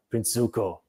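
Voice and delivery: lightly voiced wise old uncle; a hint of a calm old sage; Uncle Iroh wise voice. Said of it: "it's his wise voice, you know, wise old man voice".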